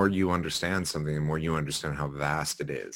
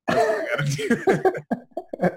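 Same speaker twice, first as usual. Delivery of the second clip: slow, high and laughing; 5 dB louder, slower than usual. Real, not acted. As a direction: squeal it out laughing. Laughing at own joke.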